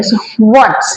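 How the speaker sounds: what's said as one syllable, wots; what's with the ts joined; what's contracted. Shown as a demonstration. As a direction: model what's clipped to wots. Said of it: "तो what's बोलेंगे".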